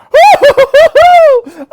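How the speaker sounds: very high triumphant hoot; shrieking laugh. Pretend. Viewer crowing at the commentator, shrieking. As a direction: crow with glee.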